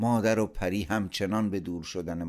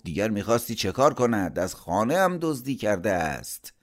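indignant, raised voice; angry accusation; 4 semitones higher, 5 dB louder than usual. A narrator's reading. Uncle accuses nephew of theft, angry. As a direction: throw the accusation out, higher and louder.

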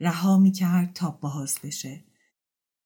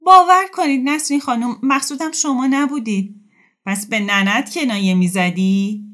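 high, loud flustered pleading; a hint of a nervous woman; flustered apology; 8 semitones higher, 9 dB louder than usual. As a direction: scramble to apologise. Anxious apology then retort.

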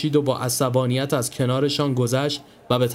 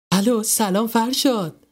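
high, slow eager hello; happy greeting; 9 semitones higher than usual. Acted. Marzieh answering the phone, hoping it's Farshad, eager. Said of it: "با خوشحالی آن را برداشت".

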